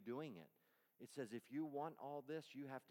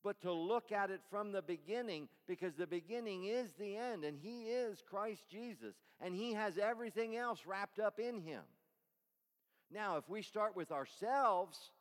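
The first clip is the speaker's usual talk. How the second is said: loud, rising sermon voice; forceful preaching; 8 semitones higher, 10 dB louder than usual. Acted.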